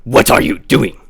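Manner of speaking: loud, challenging demand; stern guard captain. Acted. Guard captain confronts charging Luke, loud challenge. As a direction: call someone out sharply.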